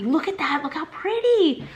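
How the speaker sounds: high, giddy gush; delighted squeal. Real, not acted. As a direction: coo excitedly over something adorable. Gushing over her new plant.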